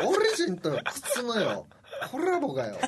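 オリジン with a trilled, rolled り, laughing. Pretend. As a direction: roll the r in オリジン while laughing. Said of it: "こんな巻き舌で言うことある？".

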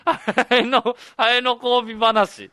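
loud, high words through real laughter; laughing disbelief. Real, not acted. Co-host marvels at the absurd topic, laughing disbelief. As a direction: crack up while slowly getting the topic out.

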